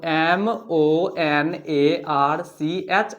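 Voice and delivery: drawn-out classroom spelling; slow spelling. Shown as a demonstration. Teacher writing stomach on board, spelling slowly. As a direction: spell stomach out one letter at a time, very slowly. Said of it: "आप add करिएगा S".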